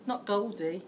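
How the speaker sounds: ordinary delivery, nothing marked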